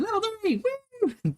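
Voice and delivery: high-pitched whoop; excited cheer. Retold or imitated. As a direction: cheer it like a kid levelling up. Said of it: "He says".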